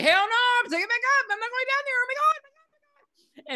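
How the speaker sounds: high, fast panicked squeal; mock panic, voice shooting way up; freaking out. Retelling the movie, she hams up Chip freaking out. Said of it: "Chip starts freaking out".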